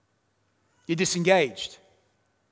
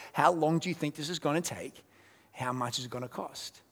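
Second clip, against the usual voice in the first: softer, unhurried repeat; softened questions; 3 semitones lower, 9 dB quieter than usual. Real, not acted. Pastor repeating the two questions to the church, hushed. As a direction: ask it gently, almost to yourself.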